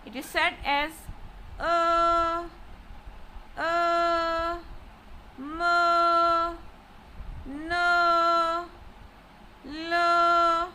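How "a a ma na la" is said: "a, ma, na, la" each held long on one high note; high level tone. Shown as a demonstration. Teacher drilling first tone, "a, ma, na, la" long and level. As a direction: sing out "a", "ma", "na", "la" evenly, pitch unchanging. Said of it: "high level tone".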